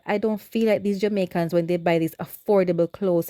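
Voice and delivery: ordinary talk, nothing marked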